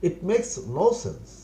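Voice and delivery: very slow, each word laid out for learners; slow model phrase. Shown as a demonstration. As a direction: spell it out slowly for the class. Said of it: "teacher models English phrase". (ধীরে ধীরে শেখানোর ভঙ্গিতে)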